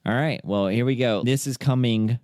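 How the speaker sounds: usual speaking voice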